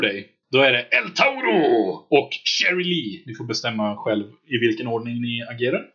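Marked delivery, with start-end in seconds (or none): 0.5-3.2 slow, higher-pitched ring-announcer call; hammed-up announcer. Game master calls El Tauro and Sherry Lee, hammed-up announcer. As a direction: stretch the names like introducing fighters.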